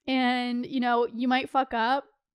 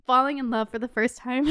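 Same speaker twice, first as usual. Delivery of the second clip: ordinary talk, nothing marked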